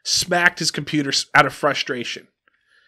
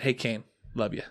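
much softer, a gentle morning hello; tender wake-up; 5 semitones lower, 11 dB quieter than usual. Retold or imitated. Dad wakes his son gently, soft and tender. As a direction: say it softly so you don't startle him.

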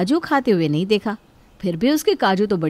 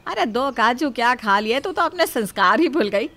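ordinary talk, nothing marked

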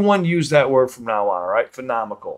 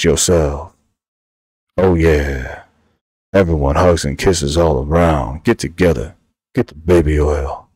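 low, loud, right on the mic; eating the mic voice; 7 semitones lower, 5 dB louder than usual. Pretend. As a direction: press close to the mic and rumble low. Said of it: "The old eating the mic voice".

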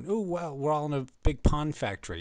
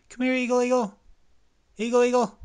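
high, loud summoning call; pitched-up repeated call; calling a bird; 14 semitones higher, 7 dB louder than usual. Acted. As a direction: holler for the eagle to come.

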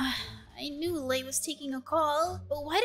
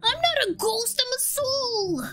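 indignant protest; 7 semitones higher than usual. Acted. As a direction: bristle at the word ghost. Ghost protests being called ghost.

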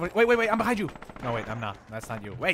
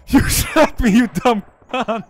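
loud, higher outraged yell at a teammate; angry yell; 6 semitones higher, 7 dB louder, slower than usual. Real, not acted. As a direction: blast the teammate who shot you. Teammate shot him.

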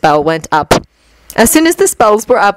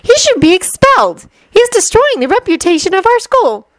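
high-pitched indignant schoolgirl; prim outrage in a high girl's voice; huffy; 13 semitones higher than usual. A narrator's reading. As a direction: huff in disapproval, pitched way up. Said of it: "Hermione huffed".

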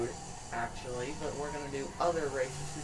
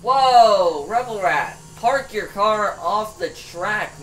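excited yelling; 5 semitones higher, 13 dB louder, slower than usual. Real, not acted. Yelling at rival car.

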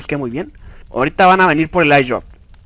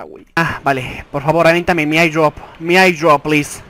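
normal talking voice

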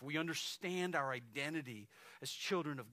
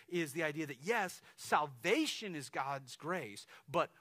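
louder sermon delivery, pressing each point; forceful preaching; 3 semitones higher, 6 dB louder than usual. Acted. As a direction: drive the message home to the church.

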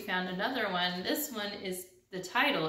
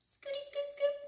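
very high, soft small-bird voice; a tiny duckling scooting in, quietly; little duckling; 6 dB quieter than usual. Acted. The duckling creeping up to the pigeon, tiny and soft.

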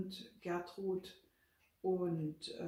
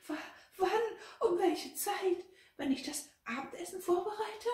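very high, loud, stuttering question; frightened squeak, halting at the start; panicky stammer; 13 semitones higher, 6 dB louder than usual. A narrator's reading. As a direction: stammer it out in rising alarm. Frightened Gertrud stammers an urgent question.

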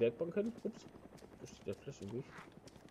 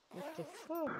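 surprised; 7 dB quieter than usual. Real, not acted. Surprised outburst at game glitch.

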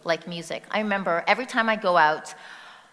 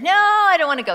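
higher, louder mock whiny no; a grumpy kid refusing the walk; loud refusal; 11 semitones higher, 11 dB louder than usual. Wife refusing husband's walk offer, loud and whiny.

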